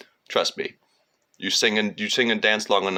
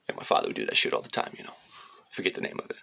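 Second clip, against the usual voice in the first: usual speaking voice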